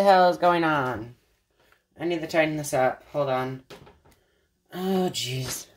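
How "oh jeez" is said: normal talking voice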